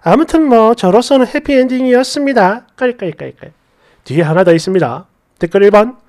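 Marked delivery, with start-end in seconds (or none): 2.8-3.5 soft, low spoken-out laugh; mock cackle. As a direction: toss off a half-hearted fake cackle. Reads out a written laugh.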